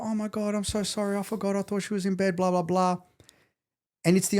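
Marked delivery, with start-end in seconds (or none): 0.0-3.0 high mocking voice of the careless ex; mimicking the ex. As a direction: parrot her flustered excuses up high. Mimicking ex's excuses.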